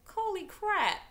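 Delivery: high, loud stunned exclaim; amazed. Retold or imitated. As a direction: blurt it out floored. Reaction to in-house financing.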